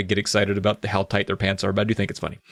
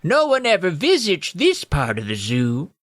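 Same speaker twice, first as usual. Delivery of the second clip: put-on old gnome; 6 semitones higher than usual. Old gnome likes the hiding place, squeaky. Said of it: "the old gnome said".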